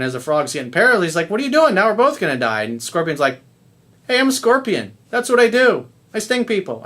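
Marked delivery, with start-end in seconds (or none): 1.3-2.6 high-pitched, lightly acted dismay; mock alarm. Paralyzed frog asking scorpion why, mock alarm. Stung frog protests as it dies. 4.1-6.8 high-pitched, unapologetic storyteller's scorpion; matter-of-fact excuse. Acted. Scorpion explaining itself to the dying frog, unapologetic.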